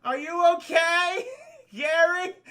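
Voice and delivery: high, slow, loud call into the dark; mock desperate shout. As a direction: yell for a lost friend, voice cracking. Calling out for missing Gary.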